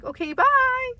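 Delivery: high, loud, cheerful bye; bright send-off to her parents; happy goodbye. Retold or imitated. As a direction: toss off a delighted bye. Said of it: "Like, I was happy".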